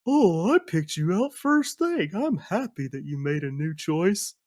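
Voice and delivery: high, swooping happy bear; cheery Yogi-style sidekick voice; cartoon bear. Bear is happy about the new choice, high cartoon voice. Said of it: "then the bear is just like".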